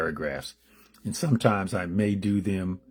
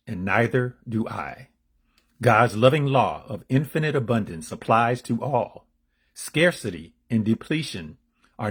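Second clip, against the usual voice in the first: deliberate inspirational affirmation; read-aloud declamation; 3 semitones higher, 3 dB louder than usual. Acted. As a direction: proclaim the meditation with measured emphasis. Reads the daily meditation.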